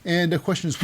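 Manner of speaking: normal talking voice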